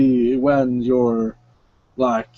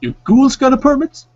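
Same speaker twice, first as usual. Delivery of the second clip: much higher, louder wary question; skeptical clerk; 12 semitones higher, 6 dB louder than usual. Acted. Javier asks the ghoul for a permit, much higher and wary. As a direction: eye the ghoul suspiciously. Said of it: "look at you for a minute".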